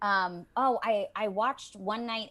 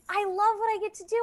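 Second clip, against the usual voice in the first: high, fast, bubbling over with love for the work; excited gush; 12 semitones higher, much faster than usual. Real, not acted. Voice actor gushing to host about her job, high and fast. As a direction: let the joy spill out quickly.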